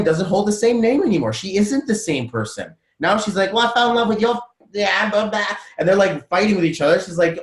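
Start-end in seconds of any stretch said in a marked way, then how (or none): 3.5-5.5 high, mock-quarrelling Australian voice; Aussie accent. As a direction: bicker in a put-on Aussie accent, trailing into blah. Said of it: "that was my Aussie".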